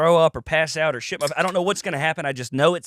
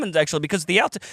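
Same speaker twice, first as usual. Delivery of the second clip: usual speaking voice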